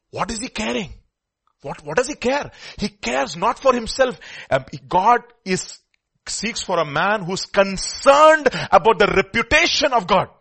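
high, loud and slow proclamation; loud preaching. Real, not acted. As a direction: burst out with conviction. Preacher erupts after whisper.

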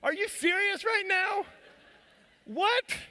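high-pitched, incredulous shriek; shocked disbelief. Retold or imitated. Dad to son who broke a tooth, high incredulous shriek. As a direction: squeal it in stunned disbelief.

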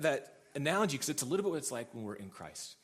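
normal talking voice

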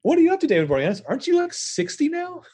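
high squeaky teasing jab. Real, not acted. Podcaster teasing David Boreanaz, high squeaky tease. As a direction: pitch way up to mock the actor.

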